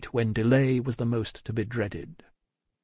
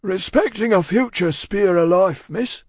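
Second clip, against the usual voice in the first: loud, raised, rustic character voice; solemn Cockney servant; 8 semitones higher, 6 dB louder than usual. A narrator's reading. Cruncher answering Miss Pross, solemn and earnest. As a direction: declare it earnestly, well above your usual pitch. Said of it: "who was all in a tremble".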